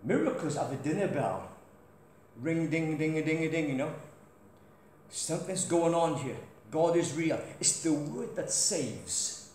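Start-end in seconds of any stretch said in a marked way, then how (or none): none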